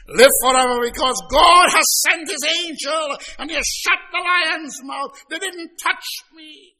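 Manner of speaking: Daniel calling up from the lion's den in triumph; jubilant call. Acted. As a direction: shout the good news up to the king.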